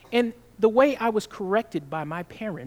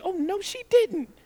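higher, sassy mock outrage; 11 semitones higher than usual. Preacher mocking his own shocked reaction in line, sassy. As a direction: play it as a scandalized onlooker.

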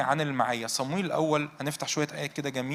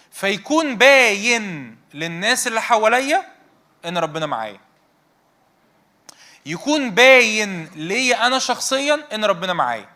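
slow, louder and higher, pressing each phrase; fervent preaching; 3 semitones higher, 6 dB louder than usual. Acted. Preacher urging the congregation to feel God near, fervent and loud. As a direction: preach it to the back row with rising force.

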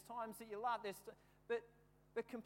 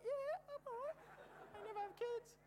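far higher, strained squeak; squeaky injured boy. Retold or imitated. Hurt boy telling the teacher he's fine, in a tiny squeak. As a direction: squeeze it out like he's been hit below.

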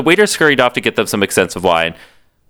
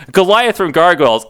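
ordinary talk

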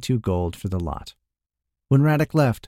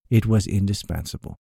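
ordinary delivery

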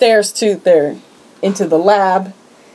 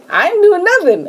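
mock innocence; 12 semitones higher than usual. Retold mock-innocent denial.

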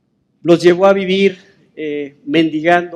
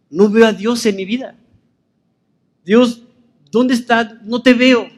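higher-pitched appeal to God; anguished questioning; 5 semitones higher than usual. Acted. Believer asking God where he is, anguished questioning. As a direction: call out searchingly, pitch climbing. Acted complaint to God in doubt.

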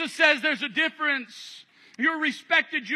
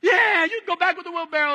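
high, loud crowd answer; the crowd egging the walker on, high and loud; eager crowd; 6 semitones higher, 5 dB louder than usual. Acted. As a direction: shout back like a cheering crowd.

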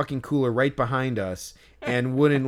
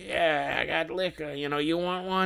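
put-on higher, softer drunk vendor offer; drunk vendor imitation; 5 semitones higher, 4 dB quieter than usual. Drunk vendor offering the guard a drink, higher and softer. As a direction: offer it like a tipsy hawker. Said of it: "he's like".

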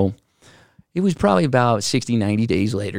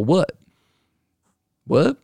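higher, disbelieving what, what; shocked what; 7 semitones higher than usual. Retold or imitated. Retelling his shock at the lost savings, higher and disbelieving. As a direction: blurt it in shock. Hearing money is gone.

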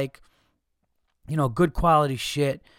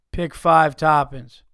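mock disinterest; 7 dB louder, slower than usual. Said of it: "he's looking to the side, not even looking at me".

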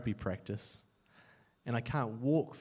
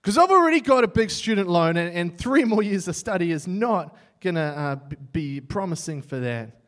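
louder, higher challenge to God; defiant prayer; 4 semitones higher, 8 dB louder than usual. Retold or imitated. Young man challenging God about student loans, loud and defiant. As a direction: demand a clear sign, half joking. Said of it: "And I'm like".

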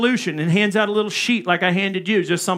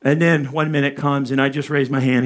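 ordinary delivery

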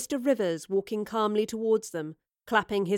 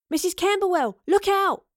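high, loud warning shout; urgent cry; 10 semitones higher, 6 dB louder than usual. A narrator's reading. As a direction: cry out in alarm to warn someone. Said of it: "Astrid cried".

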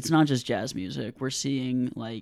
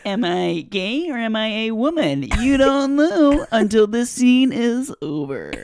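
high, loud, slow campy voice; 11 semitones higher, 12 dB louder than usual. Acted. Comedian performs the hammy improv stock voice. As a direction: ham up the camp character.

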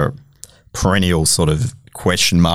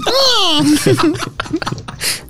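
screechy seagull voice. Pretend. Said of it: "Can you do a seagull impression?".

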